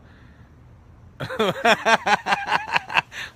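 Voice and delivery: big high burst of laughter; real loud cackle. Real, not acted. Laughing at his own silliness, loud burst. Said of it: "I don't even know what I'm doing".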